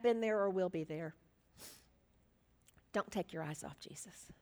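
soft, low preaching with long pauses; hushed pastoral appeal. Real, not acted. Preacher to those in a storm, hushed appeal. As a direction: drop nearly to a whisper and plead quietly.

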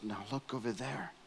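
quiet guide pointing across the field; hushed guide. Retold or imitated. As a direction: murmur it like a guide not wanting to spook the herd. Safari guide quietly pointing out zebras.

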